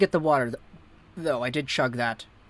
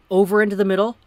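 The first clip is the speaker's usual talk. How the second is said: ordinary delivery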